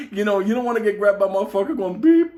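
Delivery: ordinary talk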